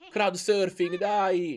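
loud cheering, slowing on bagno di folla; loud celebratory cheer. Real, not acted. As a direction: whoop it up with the crowd.

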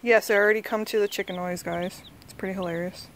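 usual speaking voice

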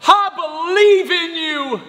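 put-on high, slow child's cheer; Finley. Daughter encouraging her dad before the backflip, wholehearted. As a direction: stretch it out high and loud like a little girl. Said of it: "she goes".

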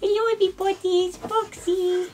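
high pet-talk voice; high doting voice to the pet getting scratched. Real, not acted. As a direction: coo at Foxy during a back scratch. Baby talk to second pet.

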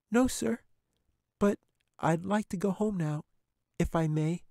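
put-on high young girl's voice; little girl. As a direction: reassure the bear like a frightened little girl. Katy answers the bear.

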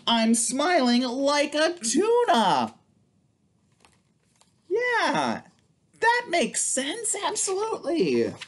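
playful cartoon voice; silly high voice. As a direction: squeak it like a cartoon fish. Silly voice for tuna page.

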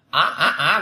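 "Á" with a rising Vietnamese tone; repeated "Á" in Vietnamese rising tone. Shown as a demonstration. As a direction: say "Á" as the Vietnamese vowel, pitch sweeping up. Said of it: "Việt Nam thì nghe nó là buồn cười".

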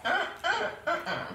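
breathy laughing grunts; chuckling uh uh. Real, not acted. Laughing at own joke.

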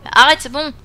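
usual speaking voice

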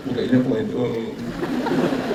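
imitated sobbing, trailing into a drawn-out wail; mock crying. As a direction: act out tearful protesters choking up. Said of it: "막 울고". (우는 흉내)

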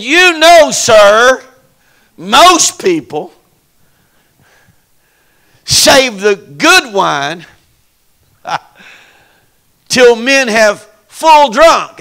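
loud, high, slow whoop; whooping preacher; preaching at full force. Acted. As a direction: stretch it out and shout. Governor's loud slow praise.

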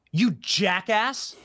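slow, contemptuous jab; slow sneering insult; scornful insult. Real, not acted. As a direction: spit it out with disdain. Insulting the anchor.

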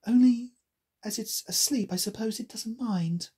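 a hint of a girl thinking; Alice. A narrator's reading. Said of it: "thought Alice".

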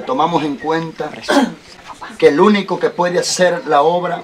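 steady, fervent preacher's prayer. Acted. Pastor praying aloud over Carmelo, fervent prayer. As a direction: pray it aloud for the whole church to hear.

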